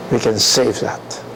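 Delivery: normal talking voice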